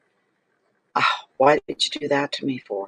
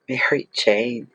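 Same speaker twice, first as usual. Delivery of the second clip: ordinary talk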